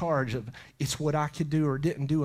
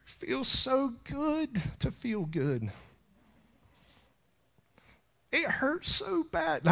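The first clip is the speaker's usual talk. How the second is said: aching tenderness; 8 semitones higher, 5 dB quieter, slower than usual. Real, not acted. Overwhelmed by God's love.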